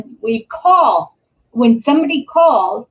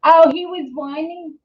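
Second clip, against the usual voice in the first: ordinary delivery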